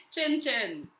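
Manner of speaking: ordinary talk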